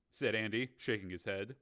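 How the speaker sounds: plain reading voice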